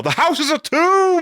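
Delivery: high, hammed-up doom yell; mock yell. Yelling when power goes out in a thunderstorm, mock doom. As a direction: yell it high as a melodramatic alarm. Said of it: "I'll just go yell".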